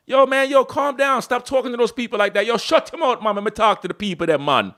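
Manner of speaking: a Jamaican voice telling him to calm down; Jamaican accent. Acted. Second Jamaican voice reining in the rough one, Jamaican accent. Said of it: "my Jamaican brother wanna come in too".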